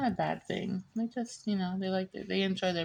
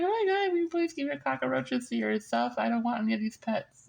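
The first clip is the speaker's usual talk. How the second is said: exasperated plea; 9 semitones higher than usual. Retold or imitated. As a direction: beg the filthy neighbors to keep their roaches, way up high. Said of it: "I'm like".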